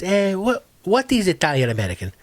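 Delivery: quick puzzled question as a retold chef; Italian chef. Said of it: "he's talking to this Italian chef".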